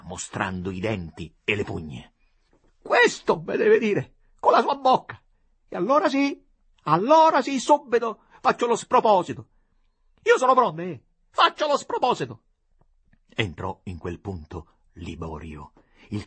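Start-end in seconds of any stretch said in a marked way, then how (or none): 2.8-12.4 higher, louder, hysterical threat; ready to do something rash, half screaming; frantic threat. A narrator's reading. Desperate woman threatens to do something rash, frantic.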